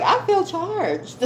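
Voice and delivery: slow, high, satisfied drawl; drawn-out high sass. Pretend. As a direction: savor it, pleased. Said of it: "I'm like".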